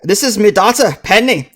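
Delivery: high dwarf brogue; Grisbeck Hearthstone. Acted. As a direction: present her like a proud dwarf father. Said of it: "he explains".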